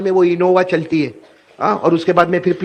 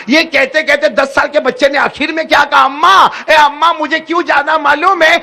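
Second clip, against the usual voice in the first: building plea; 2 semitones higher than usual. Acted. Child's pleading builds to cry.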